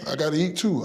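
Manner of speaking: usual speaking voice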